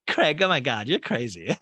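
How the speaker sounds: high exclamation framed by laughter; mock amused. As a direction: imitate a laughing, incredulous reaction. Retells Paige laughing at Craig.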